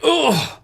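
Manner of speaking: high, loud mock-pained groan; hammed-up cry of hurt. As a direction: recoil as if cold air hit you. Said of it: "that's like".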